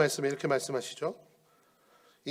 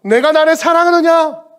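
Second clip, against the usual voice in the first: high, loud searching question; Jesus asking loudly; 12 semitones higher, 16 dB louder than usual. Acted. Jesus presses Peter with a loud, ringing question. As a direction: call the question out from the pulpit.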